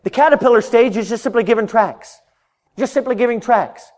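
high, loud fervent sermon; full-force preaching. Real, not acted. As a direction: preach it out to the back row.

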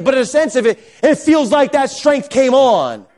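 high, emphatic preacher delivery; full-force preaching. Acted. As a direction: drive each point home with stretched key words.

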